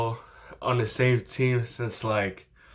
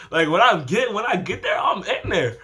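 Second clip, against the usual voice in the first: excited boast; 7 semitones higher than usual. Retold or imitated. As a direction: boast it eagerly. Hyped boast, raised voice.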